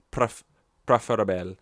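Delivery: English word in a hammed-up Spanish accent; bad Spanish accent. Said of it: "me saying English with a bad accent".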